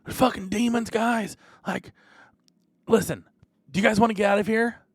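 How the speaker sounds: high, fast urgent pitch to the guards; urgent warning. Acted. Rogue urging the guards to flee the demons, high and urgent. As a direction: sell the danger and the escape. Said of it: "He goes".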